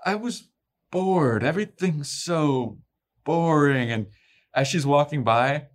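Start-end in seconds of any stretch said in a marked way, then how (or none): 0.0-3.9 higher, languid bored vampire drawl, stretching bored and boring; the evil twin acting out her boredom, high and languid; vampire Willow. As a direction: lounge on every word like nothing matters. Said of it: "snap into this vampire Willow character".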